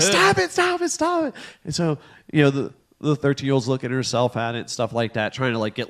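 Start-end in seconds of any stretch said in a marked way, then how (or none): 0.0-1.3 high, squealed protest; mock teenage girl shrieking in annoyance; rapid-fire high whine, half laughing; imitated exasperated teen. Imitates thirteen-year-old's protest.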